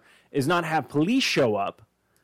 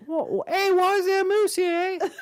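much higher, cartoonish Canadian surprise ending on eh; mock Canadian. Burglar puzzled to find a moose, in a high mock Canadian voice. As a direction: squeal it like a startled Canadian.

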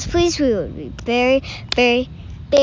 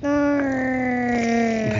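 Chewbacca roar attempt; 4 dB quieter than usual. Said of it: "That's pretty good".

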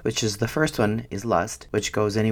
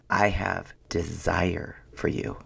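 low, slow, softened and deliberate; unhurried low-pitched avowal; retold tender declaration; 5 semitones lower, 4 dB quieter, slower than usual. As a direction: imitate a sincere romantic confession, pausing between phrases. Husband's tender declaration, acted.

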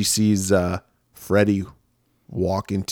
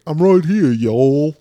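loud, higher mock Southern drawl; Southern accent; 5 semitones higher, 5 dB louder, slower than usual. Boyfriend pops out from a bush, mock Southern accent. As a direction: put on a hammed-up Southern accent. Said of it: "He has a Southern accent".

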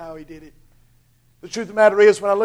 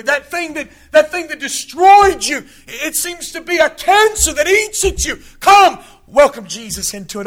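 loud rising sermon cadence, higher and louder; preacher pressing the point home; preaching at full force; 6 semitones higher, 8 dB louder than usual. Acted. Preacher driving home human weakness, loud full-force preaching.

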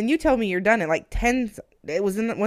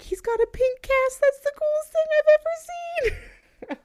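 very high, slow gushing squeal; gushing coworkers; slower than usual. Retold or imitated. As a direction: gush like thrilled office girls. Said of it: "they were all like".

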